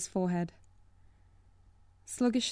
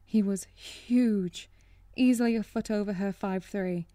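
ordinary delivery, nothing marked

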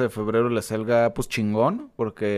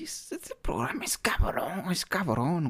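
a soft whispered aside; 5 semitones higher, 6 dB quieter, slower than usual. Real, not acted. Host confiding about the show, whispering. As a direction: whisper it like a secret.

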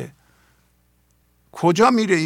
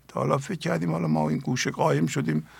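ordinary talk

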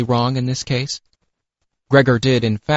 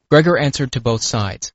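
plain reading voice

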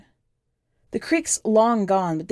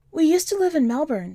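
put-on higher young girl's voice, plain and matter-of-fact; the kid's voice; 8 semitones higher than usual. A narrator's reading. The kid telling the stranger about her parents, in a higher child voice. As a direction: lift the pitch to play the nine-year-old.

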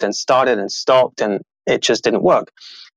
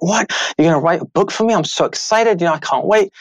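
put-on higher-pitched, thrilled kid's reply; imitating excited child; 6 semitones higher than usual. Son to dad on being promised a book, excited. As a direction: act out a son's delighted surprise. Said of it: "he was so excited".

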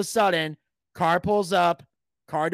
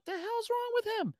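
incredulous aside pitched way up and soft; high soft aside; 9 dB quieter than usual. Retold or imitated. Narrator baffled by the heckler, a high soft aside. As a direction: mutter it to yourself, baffled.

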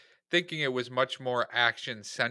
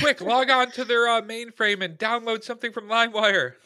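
high, giggly mock command; barely holding back laughter as you bark it; laughing mock order; 10 semitones higher, 4 dB louder than usual. Cohost laughing while playing the hacker giving orders.

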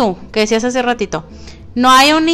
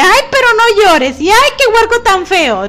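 high, louder mock mother soothing; mom consoling; 9 semitones higher, 5 dB louder than usual. Mother consoling her dumped daughter, high and cooing. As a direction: coo like a mom comforting her girl. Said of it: "Ajá, sí, de que".

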